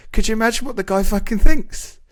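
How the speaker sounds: shrill disbelief at the thief's mistake; incredulous retelling. Retold or imitated. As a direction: relive her outrage at the absurd theft. Retelling her friend's disbelief.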